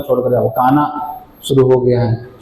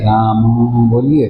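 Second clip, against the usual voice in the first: राम drawn out very slowly, lower; slow, unhurried Ram; 3 semitones lower, much slower than usual. Shown as a demonstration. Guru shows disciples to say Ram slowly, drawn out. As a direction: let राम बोलिए stretch out with no hurry. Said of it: "फिर आराम से बोलिए".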